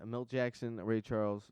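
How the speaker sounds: ordinary talk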